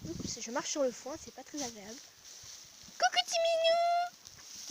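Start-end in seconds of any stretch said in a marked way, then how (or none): 3.0-4.7 much higher, slow sing-song coo. Real, not acted. Cooing high and slow to greet the cat.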